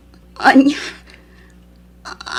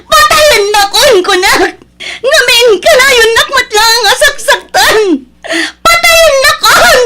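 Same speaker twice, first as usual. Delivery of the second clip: much higher, louder, tearful yells; screaming fight; 9 semitones higher, 7 dB louder than usual. Acted. Wife daring husband to kill her, screaming sobs. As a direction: scream defiance through tears.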